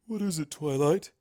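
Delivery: lightly voiced Luna, just woken; a hint of a drowsy royal voice; a light touch of a groggy princess; sleepy princess Luna.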